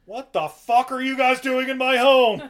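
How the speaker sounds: loud, high outraged homeowner yell; furious old man. Retold or imitated. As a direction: bellow at the intruders in your house. Homeowner finds intruders.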